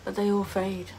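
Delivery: reflective dramatic reading with long pauses; measured, pensive recital, fading at phrase ends; wistful monologue. Acted. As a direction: deliver a heartfelt soliloquy on loss, phrase by phrase. Performs a written monologue about loss.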